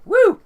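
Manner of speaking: very high, loud "woo"; short piercing cheer; excited whoop. Real, not acted. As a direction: whoop it up for a favourite show. Cheering for favourite Trek series.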